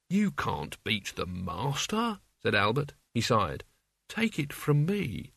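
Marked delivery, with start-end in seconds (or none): 0.1-2.2 put-on slow, soft old servant's voice; weary, slow and hushed. A narrator's reading. Old servant Albert warns Mort. 4.1-5.3 put-on slow, soft old servant's voice; weary, slow and hushed. A narrator's reading. Albert sighs and advises.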